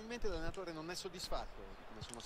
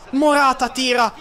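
ordinary talk

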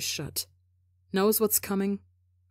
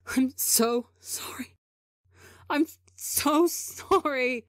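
slow, higher, sobbing sorry; yowling apology; 7 semitones higher, slower than usual. Acted. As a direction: wail the apology through sobs. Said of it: "Tebo yowls".

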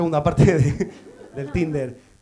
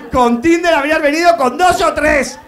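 punchline shout; 9 semitones higher, 10 dB louder than usual. Acted. Joke punchline to audience member.

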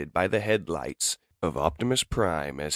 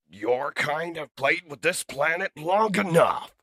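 furious robot leader; 11 semitones higher, 5 dB louder than usual. Acted. Enraged leader confronts the Seeker.

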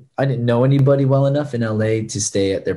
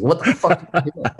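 higher, lightly voiced gruff-greeting impersonation; Chad impression; 6 semitones higher than usual. Boss greeting his trainee at five AM, lightly imitated, higher. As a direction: imitate a boss's startled challenge, pitched up. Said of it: "you'll hear my Chad impressions".

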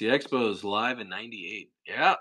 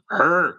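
growl; 7 semitones higher, 7 dB louder than usual. Real, not acted. Annoyed growl at comedian.

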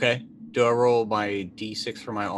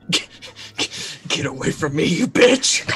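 rising to a much higher, louder cry; Sarah Connor scream; 5 semitones higher, 6 dB louder than usual. Acted. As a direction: scream it while backing away. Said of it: "I know exactly what I would scream".

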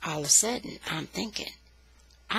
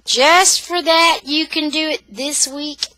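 slow, raised, emphatic punishment; stern father; 7 semitones higher, 6 dB louder, slower than usual. A narrator's reading. Daddy punishing his complaining son with more mowing, stern. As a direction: lay down the penalty slowly.

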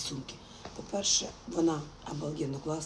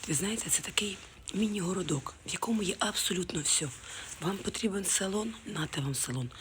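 usual speaking voice

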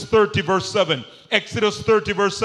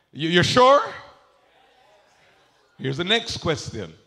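usual speaking voice

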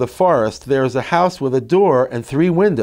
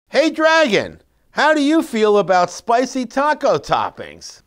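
a lightly voiced, high, playful dragon; dragon's question; 7 semitones higher than usual. Shown as a demonstration. Parent reading a picture book to a child, lightly voiced dragon. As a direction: ask it like an eager storybook character.

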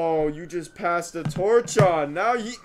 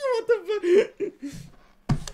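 high laughing, drawn out slowly; "What the fuck?" stretched out through real laughter; much slower than usual. Real, not acted. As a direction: laugh through the words in disbelief. Laughing at the weird film.

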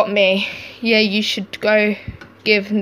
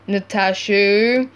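ordinary talk

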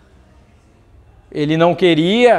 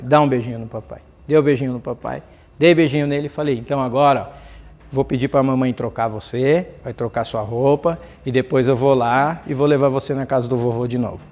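pai carinhoso; 6 semitones lower than usual. Retold or imitated. Pai fala terno com filho.

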